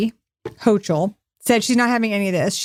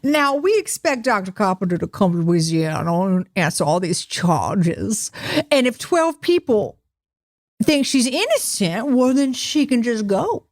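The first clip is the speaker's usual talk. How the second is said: put-on Louisiana accent. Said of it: "I gotta do the Louisiana accent".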